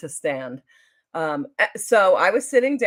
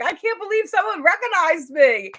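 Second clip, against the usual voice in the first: high, loud retold amazement; thrilled disbelief; 10 semitones higher, 5 dB louder than usual. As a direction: gush over an unexpected honour. Retold thrill at being recognised.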